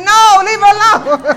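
loud, firm retort; emphatic objection. Retold or imitated. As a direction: cut in loudly and overrule them. Overruling the worried friend.

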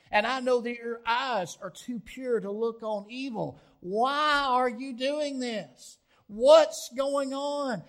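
loud, high pleading prayer rising to a shout; anguished crying out. Acted. Habakkuk crying out to God in loud anguished pleading. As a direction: cry out to God, demanding why. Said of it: "That's what Habakkuk did".

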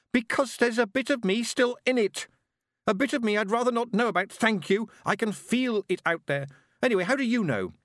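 put-on very high, overwrought girl; sustained high-pitched, distressed outburst; heated. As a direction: blurt it out in anger. Girl answers in shouted quarrel.

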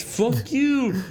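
high-pitched mock yell; unthreatening little scream. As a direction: mimic a harmless scream way up high. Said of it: "he screams a little bit".

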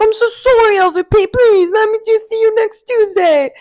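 high, pleading mock British accent; hammed-up begging in a British accent; James's British accent. Co-host mimicking castmate begging for another chance, British accent. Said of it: "of his accent".

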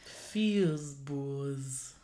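low, drawn-out mock French accent; odd French-tinged vowels, deliberately off; silly French-accented show name. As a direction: say it stupidly in a fake French accent. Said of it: "slightly off key".

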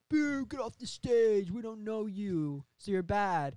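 high, drawn-out boo from the audience; heckling crowd. Acted. Bar crowd heckling the new band, jeering. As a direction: jeer them slowly off stage. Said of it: "everyone's like".

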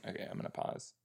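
breathy whispered aside to herself. Real, not acted. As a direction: whisper it while reaching to pause. Quietly pausing the video.

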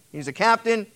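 usual speaking voice

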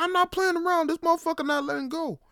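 very high, loud desperate cry; panicked kid. Retold or imitated. Boy protesting to his dad while the crab pinches him, panicked.

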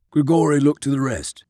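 ordinary delivery

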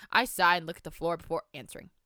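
ordinary delivery

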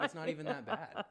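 high, loud, laughing self-reassurance; high mock reassurance. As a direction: squeak it out laughing, brushing it off. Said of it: "I'd be like".

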